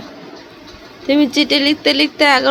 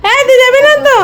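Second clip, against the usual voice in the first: louder and higher repeat of the call; 10 semitones higher, 11 dB louder, faster than usual. Real, not acted. Repeating the call when unheard, louder and higher. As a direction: call again, higher and harder.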